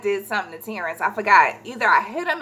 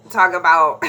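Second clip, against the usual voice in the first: usual speaking voice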